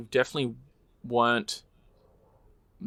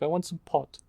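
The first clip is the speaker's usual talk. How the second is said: put-on higher, softer French accent; 5 semitones higher, 6 dB quieter than usual. Quoting the French chick's line in a French accent. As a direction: softly mimic her French pronunciation. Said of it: "I like the way it's pr-, pronounced".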